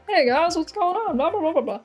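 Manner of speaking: mock vlogger greeting. Showing how to film on a phone, mock vlogger. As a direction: toss off a fake video intro. Mimes filming a phone vlog.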